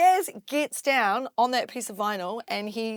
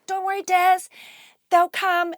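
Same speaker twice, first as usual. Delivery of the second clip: high, loud acted reassurance; urgent reassurance; 11 semitones higher, 10 dB louder than usual. Promoter runs in to the nervous DJ, urgent reassurance. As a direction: call it out to buck him up.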